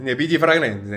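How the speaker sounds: loud, lilting mock Italian; hammed-up Italian flourish; Italian accent. Vlogger names the Florence perfume fair, playful Italian accent. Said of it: "J'essaye de parler italien".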